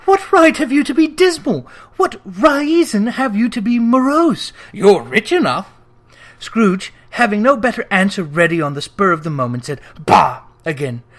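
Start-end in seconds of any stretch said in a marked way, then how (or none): 0.1-5.7 high, louder playful retort; gaily. A narrator's reading. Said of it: "returned the nephew gaily". 10.0-10.4 louder dismissive scoff from the miser; Scrooge scoffing. A narrator's reading.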